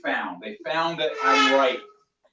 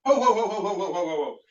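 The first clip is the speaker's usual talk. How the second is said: mock Santa laugh; 4 semitones higher, 3 dB louder than usual. Jolly burst after ringing up.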